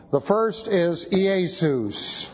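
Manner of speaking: slow, with "Iesus" said carefully as ee-AY-sous; slow Greek name. Shown as a demonstration. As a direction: give "Iesus" slowly, as a Greek word.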